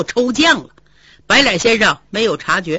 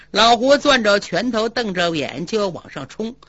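ordinary delivery

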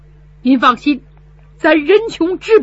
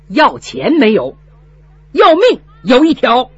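defiant loud vow; 4 semitones higher, 5 dB louder than usual. Acted. Son stands up to steward.

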